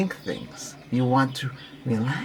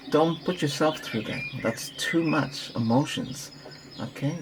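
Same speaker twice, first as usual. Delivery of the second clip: normal talking voice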